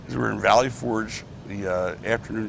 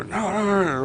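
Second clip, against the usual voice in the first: protesting; 11 semitones higher than usual. Retold or imitated. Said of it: "I hear him go".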